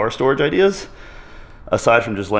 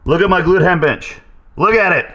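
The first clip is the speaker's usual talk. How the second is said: excited; 6 semitones higher, 8 dB louder than usual. Real, not acted. Proudly showing off drawing.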